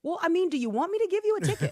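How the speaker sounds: high, loud mock officer asking; incredulous officer offering a ticket; officer's voice. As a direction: play the cop, half amused. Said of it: "And the officer says".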